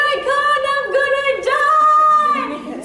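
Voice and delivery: very high, loud hammed-up wail, last word drawn out; comic re-enactment of hysterical despair; crying in panic. Sister on surfboard beside shark, hammed-up panicked wail. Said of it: "I'm just on my board crying".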